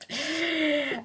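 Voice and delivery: a held-back snort of laughter; stifled laugh. Real, not acted. Laughing while holding back laughter at the cyclist. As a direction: try not to laugh but let a bit slip. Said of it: "hold my laughter back".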